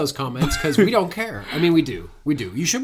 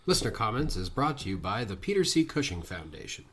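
ad read; 4 semitones lower, 7 dB quieter than usual. Acted. Sponsor read.

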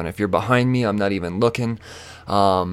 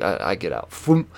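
ordinary talk, nothing marked

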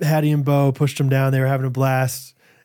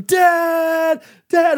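put-on high crying child's wail calling for dad; crying kid; 4 dB louder than usual. Scared son crying out to his dad, high wail. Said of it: "he's just screaming".

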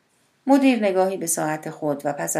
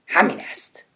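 usual speaking voice

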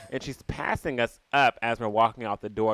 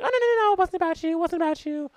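much higher, frantic denial; flustered woman; faster than usual. Retold or imitated. The woman at the theater denying it in a high frantic squeak. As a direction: squeak out a panicky no no no. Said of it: "She's like".

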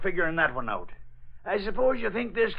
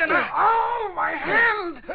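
shouting in sudden pain; cry of pain; 12 semitones higher, much slower than usual. Acted. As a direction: yelp as the hand is hit. Hand stung in the struggle.

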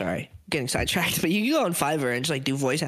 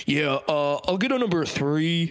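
deliberately deeper voice. Retold or imitated. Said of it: "I've been made so aware of it now that I'm like".

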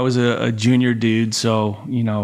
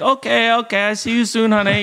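put-on high-pitched, cute Thai-accented English; Thai wife accent; 10 semitones higher than usual. Wife signing off on a call, imitated Thai accent. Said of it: "Her little accent".

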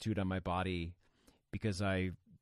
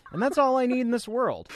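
excited; 13 semitones higher, 5 dB louder than usual. Real, not acted. Excited gushing about sweater.

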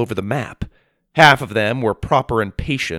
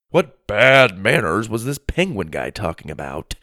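ordinary delivery

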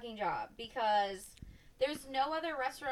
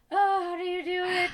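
high, loud mock-anxious outburst; anxious onlooker; 8 semitones higher, 10 dB louder than usual. Comic watching servers carry loaded trays, anxious. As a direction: fret aloud in a raised, high voice. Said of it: "I get anxious being on stage watching them".

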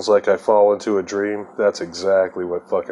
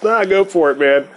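higher and a touch louder, tossed off; mock stranger brush-off; 9 semitones higher, 4 dB louder than usual. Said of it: "He goes".

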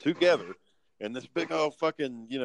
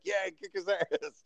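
mock villain cheer; 8 semitones higher than usual. Imagined villains cheering on fight.

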